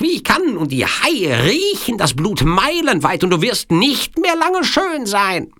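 very high-pitched, threatening villain voice, stretching key words; menacing Sicilian villain. Acted. (drohender Sizilianer mit sehr hoher Stimme)

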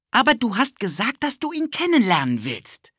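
put-on high, eager little-boy voice; a pushy kid tugging at his friend; cheeky boy Ted. Ted pulls Philipp along, eager high boy voice.

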